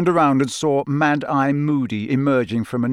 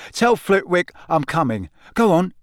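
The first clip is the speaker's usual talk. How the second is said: higher, exasperated send-off; 6 semitones higher, 4 dB louder than usual. A narrator's reading. Cedric sending his friends on to class, exasperated. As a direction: shoo them away, fed up. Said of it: "in an exasperated voice".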